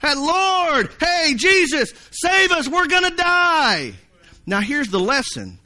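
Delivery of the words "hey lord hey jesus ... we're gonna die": high, desperate hollering; panicked yelling. Acted. Disciples yelling to Jesus in the storm, panicked cry. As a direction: cry out high, drawing out the last word. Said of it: "I bet they were".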